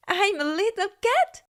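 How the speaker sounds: light up with sudden joy; delighted. A narrator's reading. Kitten learns from the owl what he is, delighted.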